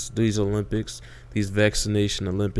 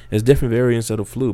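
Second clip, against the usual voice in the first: ordinary delivery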